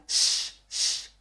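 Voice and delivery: श as a long unvoiced sh, tongue to the palate; hissed sh sound. Shown as a demonstration. Teacher demonstrating the palatal sh sound. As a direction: breathe out a soft hissing श. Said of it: "ऐसी आवाज निकलेगी".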